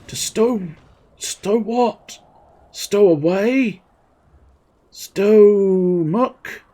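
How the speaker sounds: high, questioning tries; puzzled guessing. Acted. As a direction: puzzle over the unfinished word. Guessing at the cut-off word.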